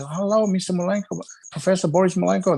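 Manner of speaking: high-pitched greeting in a put-on voice; mock fan greeting. Voicing people greeting the famous trainer.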